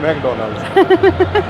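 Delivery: calling out the name like a playful chant; drawn-out high singsong. Pretend. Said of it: "बोलना तो".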